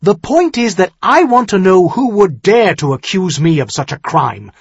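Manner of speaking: loud, high-pitched heated outburst; hot anger. Acted. Son-in-law demands who dares accuse him, hot anger. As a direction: cut back hotly, outraged at the accusation. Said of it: "Tyrion said hotly".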